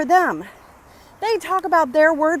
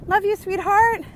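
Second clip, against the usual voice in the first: sweet greeting; 8 semitones higher, slower than usual. Real, not acted. Greeting cousin passing by.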